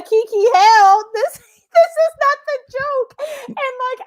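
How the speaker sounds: high laughing half-cry of shock. Retold or imitated. Retelling her shock at the test.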